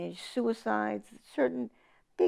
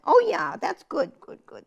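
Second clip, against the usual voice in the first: group's eager approval; 9 semitones higher, 6 dB louder than usual. Retold or imitated. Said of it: "And I'd say".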